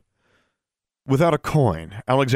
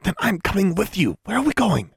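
high, pressing voice; hushed insistence; 10 semitones higher than usual. A narrator's reading. Roommate demanding to join the secret outing, insistent. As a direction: insist without raising the volume.

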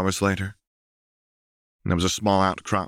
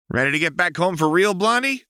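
higher, louder call across the dock; 12 semitones higher, 4 dB louder than usual. A narrator's reading. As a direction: call out to her brightly. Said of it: "Jinx called".

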